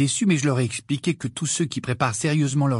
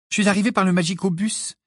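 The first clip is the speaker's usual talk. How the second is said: higher-pitched, offhand announcement; casual; 7 semitones higher than usual. A narrator's reading. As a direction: toss it off casually in a raised voice. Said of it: "dit Hermione d'un ton dégagé". (d'un ton dégagé, plus aigu)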